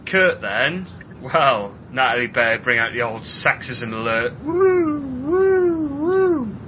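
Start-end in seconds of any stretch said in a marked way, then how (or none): 4.4-6.6 high wailing woo siren. Pretend. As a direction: wail like an alarm siren. Mock sexism alarm.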